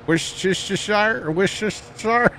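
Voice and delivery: Worcestershire garbled as "wish-tish-ta-shire", high; fumbled pronunciation. Real, not acted. Attempting a hard word for chat, fumbled pronunciation. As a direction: trip over the extra sh sounds in Worcestershire. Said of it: "Is that how you say it?".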